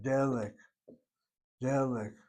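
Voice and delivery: delik accented on its first syllable, DE-lik; stress on first syllable. Shown as a demonstration. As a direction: put the weight on the de of delik. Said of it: "Notice how I'm saying that word".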